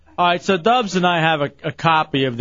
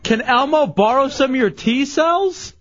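higher, slow disbelieving repeat; incredulous; 8 semitones higher than usual. Real, not acted. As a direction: repeat it in shock. Host repeats the toy line in disbelief.

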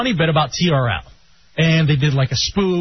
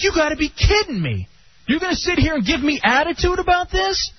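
higher, half-outraged disbelief; lightly imitated indignation; incredulous retort; 9 semitones higher than usual. Arguing with the tow clerk.

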